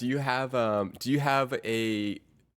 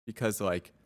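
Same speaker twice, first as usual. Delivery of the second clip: ordinary talk, nothing marked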